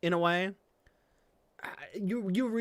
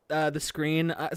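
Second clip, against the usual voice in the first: normal talking voice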